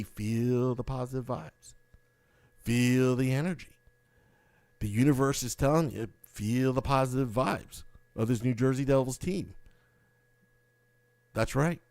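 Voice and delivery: hushed mantra about good vibes; mock guru calm. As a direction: lull them like a self-help guru. Mock guru positivity chant.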